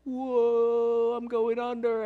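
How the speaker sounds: mock panic. Peter feeling himself sinking in the storm, mock panicked cry. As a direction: sell the sudden fright of going under. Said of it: "He's like".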